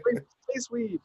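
very high pleading squeak; mock begging. Begging the aliens for weed, squeaky mock plea. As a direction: whine it like a desperate plea.

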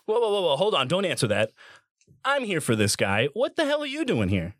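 high, protesting interruption; acted territorial outrage; indignant objection. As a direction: cut in, affronted, pitch leaping on the challenge. Acted quarrel over the captive.

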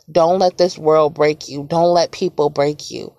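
normal talking voice

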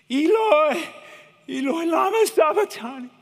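high, loud anguished cry. Retold or imitated. Jesus on the cross crying to God, loud anguish. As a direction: wail it out from the cross. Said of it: "cried out".

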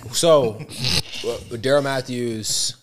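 lightly imitated friend's storytelling voice; Daryl Matthews' way. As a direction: announce it slowly like a big storyteller. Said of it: "let me set this up Daryl Matthews' way".

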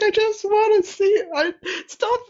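high, slow, whiny mock sob; mock crying Zuckerberg. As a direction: wail it like a restrained man begging. Zuckerberg voiced crying in straitjacket.

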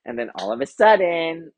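usual speaking voice

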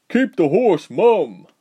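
loud, slow barked command; barked order. A narrator's reading. Soldier threatening the girls on horseback, loud slow bark. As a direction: bark it at them. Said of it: "one soldier barked".

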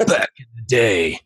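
lightly voiced Jocko, level and firm; a hint of Jocko's gravity; Jocko impression. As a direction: say it straight like Jocko. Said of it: "when Jocko says it".

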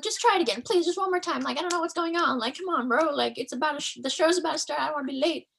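high, whiny helplessness; high mock desperation. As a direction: act lost and desperate. Retold begging to guard.

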